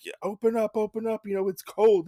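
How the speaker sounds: high, insistent call at a door; urgent pleading traveler. Retold or imitated. Cold wayfarer begging to come inside, high urgent pleading. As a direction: plead to be let in, pitch way up. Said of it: "He's like".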